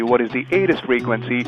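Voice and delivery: ordinary delivery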